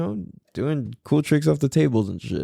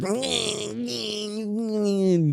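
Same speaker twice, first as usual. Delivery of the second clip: long high mock wail; drawn-out imitated whining noise; mocking whine; 9 semitones higher, 4 dB quieter than usual. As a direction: wail mockingly, sliding slowly down. Mimics over-sensitive, triggered people's whining.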